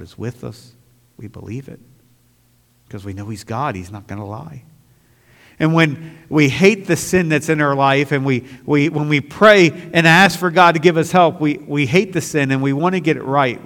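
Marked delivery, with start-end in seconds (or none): 5.6-13.6 louder, higher, driving sermon voice; full-force pulpit preaching; fiery preaching. Acted. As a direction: preach it hard to the pews.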